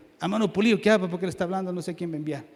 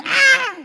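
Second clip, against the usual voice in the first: sudden loud, very high "ah!"; imitated newborn cry; 11 dB louder than usual. Preacher showing how each listener cried at birth, imitated yell. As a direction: mimic a baby's first yell. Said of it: "usted gritó y dijo".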